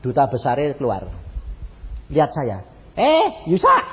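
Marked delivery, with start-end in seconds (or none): 3.0-3.8 high, loud call across the room; calling out a name. Retold or imitated. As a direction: shout his name to catch him. Duta besar memanggil namanya. (memanggil nama dengan keras)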